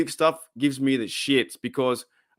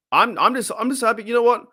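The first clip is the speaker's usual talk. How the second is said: happy and excited; 11 semitones higher, 3 dB louder than usual. Real, not acted. Streamer cheering the coach's new job, voice leaping up. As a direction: pitch jumps much higher, bubbling over.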